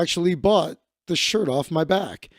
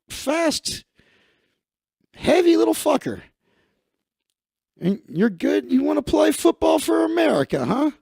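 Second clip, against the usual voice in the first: impressed American coach, pitched well up; American football scout; 9 semitones higher than usual. Acted. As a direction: holler like an excited football coach.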